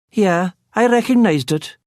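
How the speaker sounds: high Cork lilt; young barman, high and friendly. A narrator's reading. Barman recognises the accent, high Cork lilt.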